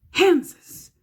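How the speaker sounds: high, loud creature blurt; loud creature guess. Acted. Gollum guessing.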